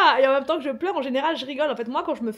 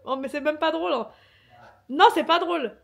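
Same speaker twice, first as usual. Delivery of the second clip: raised, higher voice, half-amused indignation; indignant protest; 4 semitones higher, 5 dB louder than usual. Real, not acted. Injured woman scolding her amused partner, indignant protest. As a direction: insist loudly that it's no joke. Tells laughing partner it isn't funny.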